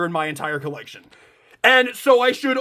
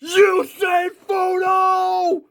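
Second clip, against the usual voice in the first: long, drawn-out announcer shout, much higher; dramatic name call; 9 semitones higher, much slower than usual. Acted. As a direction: belt the name out slowly like an anime intro. Opening call of the character name.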